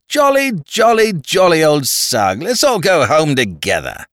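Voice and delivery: high, slow tipsy greeting; drunken cheer. Acted. Freddie cheering at the police from the taxi, tipsy. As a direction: hail them like a merry drunk.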